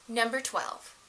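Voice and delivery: normal talking voice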